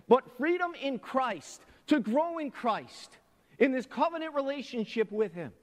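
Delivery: loud, high pulpit exhortation; impassioned sermon voice; fervent preaching. Real, not acted. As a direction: press the warning home. Preaching with rising fervour.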